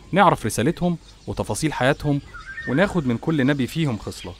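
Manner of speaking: plain reading voice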